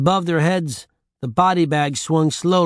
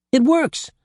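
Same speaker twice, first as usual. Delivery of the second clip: much higher, bursting with excitement; excited cry; 11 semitones higher than usual. A narrator's reading. Jack and Jill discovering the mirror works, excited cry. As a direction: shout the discovery with joy. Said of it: "they both cried excitedly".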